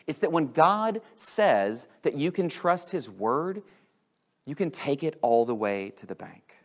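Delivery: ordinary talk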